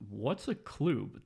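slow question with "club" said "kloob"; "club" rhyming with tube. Retold or imitated. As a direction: ask it with a long oo in "club". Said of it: "that's a club".

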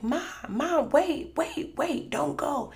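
high, repeated retold plea; urgent pleading. Daughter begging vanishing mom to stay, urgent pleading. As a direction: plead as if calling her back.

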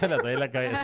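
repite la frase riéndose; la cita se le escapa con risa; repitiendo entre risas. Real, not acted. (amigo repite la frase de TikTok, riendo)

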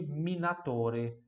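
minatore stretched slowly, syllable by syllable; slow model word. Shown as a demonstration. Teacher modelling the word minatore. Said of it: "Segunda profissão de hoje".

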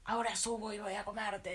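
lightly voiced troll threat; a hint of a hungry troll; troll threatening the middle goat, lightly voiced.

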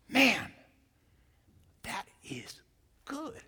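hushed, breathy awe on "that is good"; marvelling under the breath; awed whisper. Acted. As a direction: whisper it like you can't believe how good it is.

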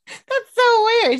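high, loud and slow, drawn-out disbelief; hammed-up high exclaim of weirdness; mock disbelief. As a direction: stretch it out, baffled and a bit appalled. Own reaction retold as exclamation.

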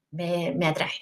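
ordinary delivery

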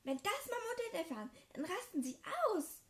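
high, loud and fast; angry threat. Acted. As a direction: rush out the threat in a rage. Draco threatens to tell parents.

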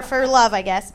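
ordinary talk

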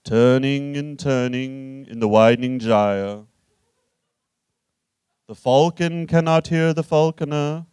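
slow, solemn recitation in a measured monotone; ponderous prize-winning poet. Acted. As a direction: intone each line with heavy authority, long drawn out. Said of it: "in my Nobel Prize winning voice".

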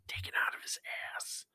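very soft, voiceless whisper; whispered aside. Real, not acted. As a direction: drop into a hushed aside. Hushed crude aside.

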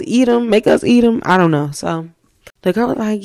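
usual speaking voice

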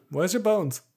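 joking about the goblin's bones; squeaky goblin. Pretend. S1 voices goblin joke.

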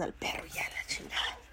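a muttered curse to herself; whispered aside. Real, not acted. Vlogger cursing under her breath to viewers, whispering.

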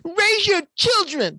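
high, slow caricature voice; exaggerated cartoon intonation. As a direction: stretch it out like a cartoon preacher. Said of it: "why are you talking like that?".

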